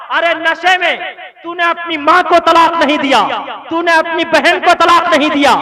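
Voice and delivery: loud, building scolding tirade; scolding rant. Real, not acted. Preacher scolding drunk husband.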